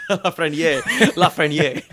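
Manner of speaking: a laughing try at French pronunciation; French accent attempt. Shown as a demonstration. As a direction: say La Frenier the French way, drawn out and laughing. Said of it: "sounded like a French person imitating an American".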